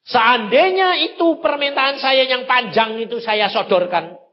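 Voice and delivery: high, loud climax; emphatic preaching. Real, not acted. Preacher stressing the key lesson, high and loud. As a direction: lean hard on this point.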